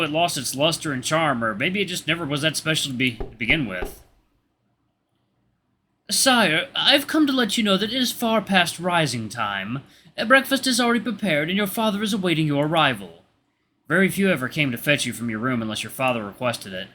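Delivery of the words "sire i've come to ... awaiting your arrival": high-pitched servant announcing; high, dutiful royal attendant; formal servant. Acted. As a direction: voice a servant calling the prince, pitched well up. Voices the servant fetching the prince.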